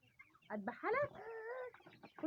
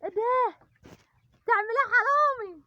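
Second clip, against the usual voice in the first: loud playful shout; mock shout; 6 semitones higher, 12 dB louder than usual. Real, not acted. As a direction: yell out in mock surprise. Catches child in coop.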